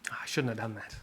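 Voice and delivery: low, soft self-reproach; remorseful father. Retold or imitated. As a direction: regret it quietly, as if to yourself. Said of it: "the father, he feels compunction".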